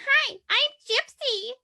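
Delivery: put-on very high pageant-kid voice; squeaky-high put-on child pageant greeting. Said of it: "this way that was very".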